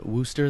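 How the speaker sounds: Worcester said as "WUSS-ter"; local pronunciation. Shown as a demonstration. Telling where the powwow was, Worcester said locally as "WUSS-ter". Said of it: "they pronounce it".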